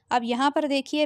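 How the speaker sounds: ordinary delivery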